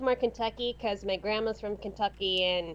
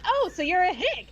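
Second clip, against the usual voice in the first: higher-pitched mock realization; blind-date guy; 7 semitones higher, 3 dB louder than usual. Retelling the blind date's reply, mock realization. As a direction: play the date jumping to a conclusion. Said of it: "the blind date said".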